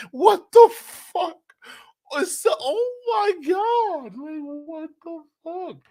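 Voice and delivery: very high, slow shriek of shock; shrieking disbelief. Real, not acted. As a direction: scream it in stunned disbelief. Shocked by the clip.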